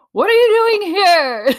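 put-on high, loud gleeful kid scream with a laugh in it; retold kids' scream, laughing. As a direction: shriek it like an excited kid who just spotted the plate. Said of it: "both of them screamed".

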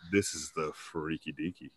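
low, soft, laughing come-on; husky low sultry line; sultry deep voice. Shown as a demonstration. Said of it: "when I get on there and say".

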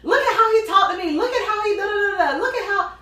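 high, loud, laughing self-defense; imitating her own old whiny protest; mock indignant victim. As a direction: play the wronged victim, hammed up. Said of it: "And I was like".

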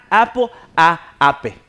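"apple" said "apo", loud and slow; beginner's English pronunciation. Retold or imitated. New student reciting in English class, halting beginner's pronunciation. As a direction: say "ape" in two Spanish syllables, "ah-peh". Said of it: "mi primera clase".

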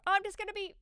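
high-pitched, sing-out stalling; breezy excuse. Acted. Family member putting off the others with eight minutes, high and breezy. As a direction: call out a high, offhand promise.

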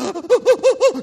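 loud high stuttering sobs; comic staccato boo-hoo sobs; mock sobbing. Shown as a demonstration. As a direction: demonstrate proper blubbering, hammed up. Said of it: "you know when you're doing that".

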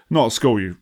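ordinary talk

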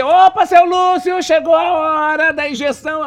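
high, loud bright call to the patient; cheery nurse. Acted. Nurse barges in to give seu Lúcio his injection, high and loud. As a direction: sing out like a hospital nurse.

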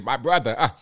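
put-on Nigerian English; Nigerian accent. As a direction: greet like a Nigerian, accent laid on. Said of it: "I did my Nigerian accent".

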